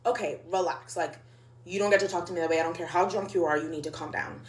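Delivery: low, soft, measured telling-off; calm a drunk friend like a patient parent; gentle parenting. Retold or imitated. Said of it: "Like, gentle parenting her".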